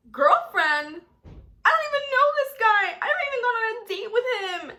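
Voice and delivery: very high, loud, fast squeal; shocked protest. Retold or imitated. Girl called girlfriend by near-stranger's mom, shocked.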